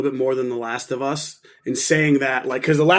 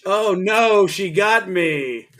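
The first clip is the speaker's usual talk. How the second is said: mock dismay; 4 semitones higher, much slower than usual. Mock cry of being caught.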